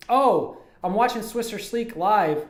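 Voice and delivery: ordinary delivery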